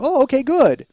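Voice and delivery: hammed-up squeaky "okay, good"; exaggerated uneasy relief. Son re-enacts his reply to mom, hammed-up high relief. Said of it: "That's what I said. I go".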